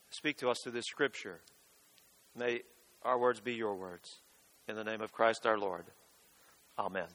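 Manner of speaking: hushed pastoral prayer. Acted. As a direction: pray quietly to God for the confirmands. Leads the congregation in prayer.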